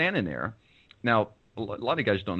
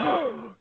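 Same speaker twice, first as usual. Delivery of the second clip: a sharp, high mock gasp; imitated crowd gasp; 13 semitones higher than usual. Shocked party crowd gasping at the fight, imitated. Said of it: "all these people are like".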